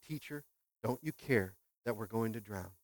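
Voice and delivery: ordinary talk, nothing marked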